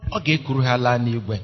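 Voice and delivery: ordinary talk, nothing marked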